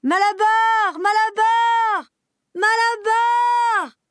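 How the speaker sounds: very high, loud desperate call; crying out. Acted. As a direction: call after him with all she has. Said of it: "cria-t-elle". (jument qui appelle Malabar désespérément)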